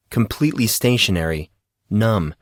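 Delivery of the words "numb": ordinary delivery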